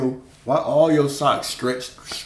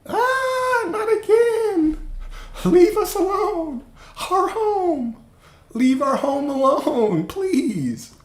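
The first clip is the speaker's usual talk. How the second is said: very high, slow, squeaky pleading bug voices; tiny insect voices; much slower than usual. Pretend. Insects begging big feet to leave their home, squeaky and pleading. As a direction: squeak out the plea like frightened little bugs.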